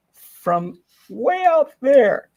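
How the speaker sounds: slow stretched-out "way out there"; drawn out. Pretend. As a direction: stretch it out slowly. Stretched for effect.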